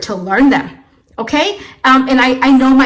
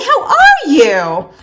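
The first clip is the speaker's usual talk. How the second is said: ARE held long and high, falling on YOU; emphasis on ARE; 3 semitones higher, much slower than usual. Shown as a demonstration. Teacher repeats the example, ARE emphasized and drawn out. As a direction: lean slowly on "are", then drop. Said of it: "like, emphasizing".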